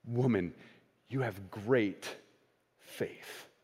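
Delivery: almost whispered blessing; hushed tender. Acted. Jesus repeating his praise to the woman, hushed and tender. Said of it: "listen to how Jesus' tone changes".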